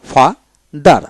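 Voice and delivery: ফাদার split as ফা, then দার; slow ফাদার with a gap between its two syllables. Shown as a demonstration. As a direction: sound out ফাদার in two separate beats. Word read out split into syllables.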